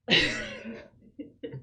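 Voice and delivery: high breathy laugh bursting out; a sudden squeak of giggling; burst of laughter. Real, not acted. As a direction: crack up mid-sentence. Laugh bursts after the whisper.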